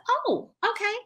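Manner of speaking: high-pitched acted acknowledgement; lilting reply to a little kid; encouraging interest. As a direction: lift it way up, as to a child.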